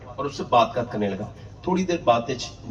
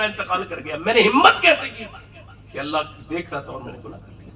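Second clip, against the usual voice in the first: ordinary delivery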